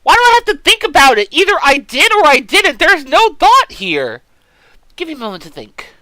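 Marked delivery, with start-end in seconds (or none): 0.0-4.2 indignant protest. Real, not acted. Indignant at accusation.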